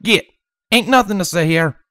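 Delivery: put-on threatening southern accent. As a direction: run them off in a southern accent. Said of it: "her deep southern accent thick and threatening".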